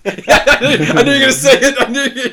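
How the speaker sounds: high, loud words through real laughter; laughing hard. Real, not acted. Co-host cracking up at the bit he saw coming, laughing hard. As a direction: blurt it out while cracking up.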